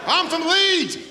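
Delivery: high, loud proud-local booing; mock Leeds heckler. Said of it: "How hilarious is that?".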